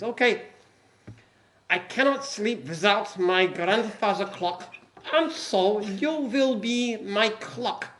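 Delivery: put-on a light German commandant accent, slow and measured. Camp commandant to prisoners, light German accent. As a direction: commandant lays down the rules in a mild German accent. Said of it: "heard you do an accent".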